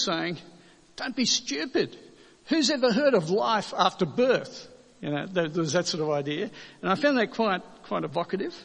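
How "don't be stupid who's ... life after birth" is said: mock-incredulous scoff, high and louder; high-pitched dismissive disbelief, playfully acted. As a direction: scoff at an absurd idea, pitch jumping up. Acted cartoon twin scoffing at brother.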